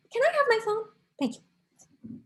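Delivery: soft, dropping to a near-whisper; hushed little request; polite aside. Acted. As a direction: break off and quietly ask. Storyteller gives up, asks for phone.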